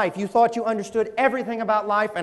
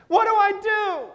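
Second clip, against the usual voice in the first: very high desperate cry; hammed-up high wail; 12 semitones higher, 3 dB louder than usual. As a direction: cry it out as if just given bad news. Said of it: "to cry out".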